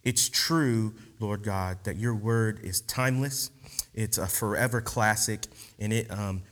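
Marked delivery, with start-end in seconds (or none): none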